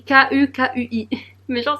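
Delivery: real laugh escaping after the letters; amused, giggle breaking in; tickled laughing. Real, not acted. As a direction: spell it out, then crack up. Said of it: "ça me fait beaucoup trop rire".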